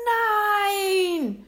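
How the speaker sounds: long, high, very loud scream. A narrator's reading. William sees the dinosaur being shot, screaming. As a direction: scream in anguish, drawn out. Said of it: "schrie William".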